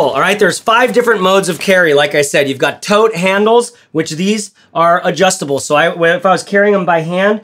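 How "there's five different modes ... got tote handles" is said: normal talking voice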